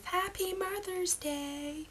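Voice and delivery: high, slow sing-out greeting; recorded card greeting. Retold or imitated. Recorded card greeting Mum on Mother's Day, high put-on voice. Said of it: "opens it up and it says".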